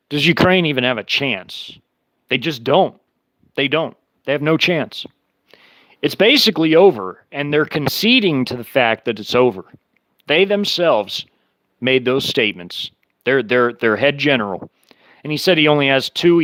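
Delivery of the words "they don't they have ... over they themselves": ordinary talk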